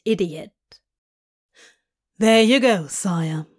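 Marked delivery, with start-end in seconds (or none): 2.2-3.5 mock courtly. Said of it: "indicates a little bow".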